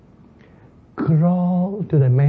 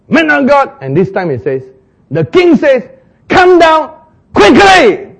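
harsher command; 10 semitones higher, 8 dB louder than usual. Acted. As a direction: stretch out quickly as a demand. Second captain commands harder.

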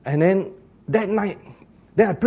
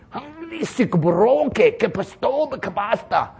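higher, slow intoned syllables; different prayer tongue; 5 semitones higher, slower than usual. Shown as a demonstration. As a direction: demonstrate a second, higher-pitched tongue. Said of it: "Different tongue".

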